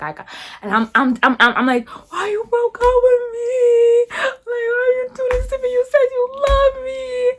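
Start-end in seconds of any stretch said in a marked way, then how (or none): 1.9-7.3 imitating own sobbing in a squeaky whine; mock bawling. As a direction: wail it like a hammed-up heartbroken plea. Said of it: "I'm crying I couldn't hold it back".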